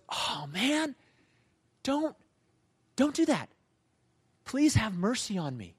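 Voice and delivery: high-pitched, mock-desperate begging; pleading. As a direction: act out a servant begging for mercy. Servant begs the king for mercy.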